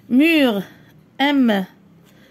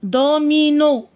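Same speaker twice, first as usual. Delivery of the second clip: "Domino" slowly, each syllable drawn out; slower than usual. Shown as a demonstration. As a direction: stretch "Domino" into do-mi-no. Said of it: "Frappe les syllabes". ("Domino" مقطعًا مقطعًا ببطء)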